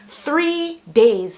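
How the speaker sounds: loud, pointed "Three days," hitting "Three" hard; indignant outcry. Real, not acted. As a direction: throw "Three days" at him in disbelief. Angry repeat of sick days.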